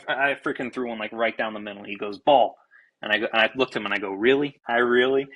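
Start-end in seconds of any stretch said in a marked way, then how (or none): 2.2-2.6 loud clipped umpire call; mock umpire call; umpire's call. Umpire calling ball loudly. 4.1-5.3 louder disbelieving really; retold incredulous protest. Arguing the call, incredulous.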